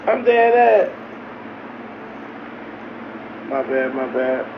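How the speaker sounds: ordinary delivery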